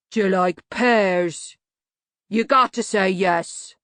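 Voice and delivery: slow, heavy-voiced simpleton; slow rumble. A narrator's reading. Banjo questioning the man he holds, slow rumble. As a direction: rumble it out, word by word. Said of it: "Banjo rumbled".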